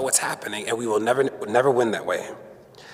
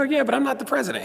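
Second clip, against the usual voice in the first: much higher, sassy comeback; cheeky retort; 12 semitones higher than usual. Retold or imitated. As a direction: toss it back with a grin. Retorting to President Obama.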